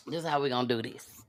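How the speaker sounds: low, fast, soft run-through; fast quiet mutter; muttered under breath. Shown as a demonstration. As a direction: mumble it quickly to yourself. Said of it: "repeats line louder".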